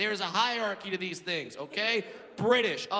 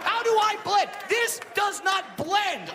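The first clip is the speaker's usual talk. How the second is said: high, loud comic disbelief; hammed-up indignant shout; exasperated outburst; 11 semitones higher, 3 dB louder, slower than usual. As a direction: protest incredulously, stretching the words out. Outraged at advice to blend in.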